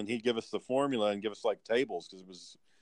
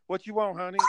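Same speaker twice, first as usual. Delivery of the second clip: drive-thru lady; 7 semitones higher than usual. Retold or imitated. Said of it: "you hear the lady's voice".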